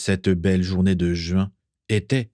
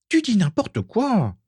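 slow, very high squeaky objection; outraged protest; 14 semitones higher than usual. A narrator's reading. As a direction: object in a high, drawn-out ghost-girl voice. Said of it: "s'insurgea-t-elle". (protestation aiguë et traînante)

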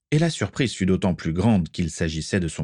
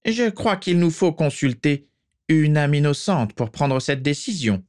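high sinister dark lord; 8 semitones higher than usual. Acted. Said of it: "continua Voldemort".

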